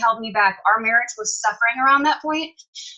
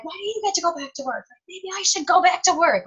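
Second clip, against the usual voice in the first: higher, pointed complaint with an edge; indignant mock outburst; 8 semitones higher than usual. Wife to husband, resenting his return to work, higher and indignant. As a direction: throw it at him like a challenge. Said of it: "I remember getting so mad".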